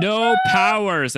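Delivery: hammed-up yakuza shout; mock scream. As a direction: bellow it slowly like a movie villain. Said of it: "just screams".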